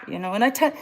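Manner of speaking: normal talking voice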